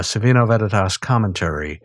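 ordinary talk, nothing marked

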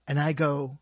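ordinary talk

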